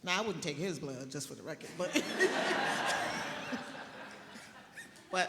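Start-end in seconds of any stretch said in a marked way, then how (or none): 2.0-5.0 a big real burst of laughter, high and loud. Real, not acted. Laughs at own remark.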